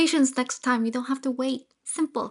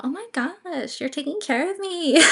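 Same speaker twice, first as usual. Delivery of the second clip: gushing, higher and delighted; touched gush; 5 semitones higher than usual. Retold or imitated. Touched girlfriend gushing over boyfriend's thoughtful offer. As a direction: swoon a little at the kindness.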